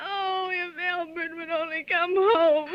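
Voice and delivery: slow, crying, breaking voice; sobbing. Acted. Exhausted wife sobbing for her husband, slow and tearful. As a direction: sob it out, giving up. Sobbing alone after the calls.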